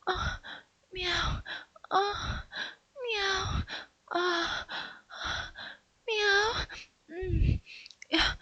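high, soft, mewling meows; catgirl mewing. Acted. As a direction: moan like a pleased kitten. Catgirl moaning while licked.

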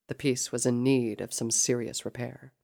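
plain reading voice

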